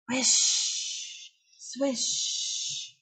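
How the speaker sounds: breathy whish swish; whooshing whale spout. A narrator's reading. Whale spouting nearby, airy whooshing sounds. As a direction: blow it out like water spraying.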